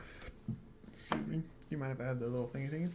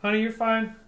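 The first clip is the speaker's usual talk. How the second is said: raised, louder husband-to-wife voice; talking-to-wife voice; 8 semitones higher, 8 dB louder than usual. Real, not acted. Husband reassures his wife off-stream, in his talking-to-wife voice. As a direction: call it out high to your honey. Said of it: "talking to my wife voice".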